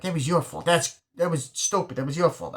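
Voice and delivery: high-pitched mock blame; imitated gangster. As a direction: lightly act out a gangster's accusing repeat. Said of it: "he's brilliant in the small scene".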